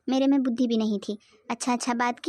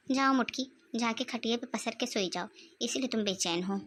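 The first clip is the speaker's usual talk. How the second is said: much softer, grumbling under her breath; quiet grumble about her mother-in-law; muttering; 4 semitones lower, 8 dB quieter than usual. Acted. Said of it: "क्या बड़बड़ाए जा रही हो".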